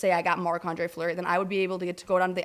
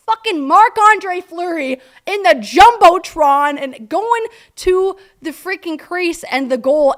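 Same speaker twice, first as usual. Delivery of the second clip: high, loud and drawn out; thrilled; 10 semitones higher, 10 dB louder, slower than usual. Real, not acted. Fan telling of Fleury on the Jumbotron, loud and thrilled. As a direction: belt it out slowly, awestruck and excited. Retelling the star goalie's surprise appearance.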